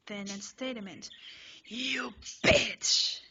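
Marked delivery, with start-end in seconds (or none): 1.7-3.2 very loud, rising shout; a very loud, enraged shout; furious yell. Acted. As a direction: blast it at her in rage. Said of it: "he yells".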